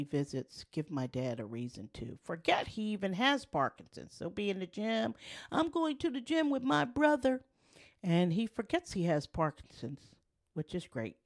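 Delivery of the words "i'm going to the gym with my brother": high, bright quoted dad; eager dad voice. Retold or imitated. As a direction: voice dad's cheerful announcement. Quoting dad going to gym.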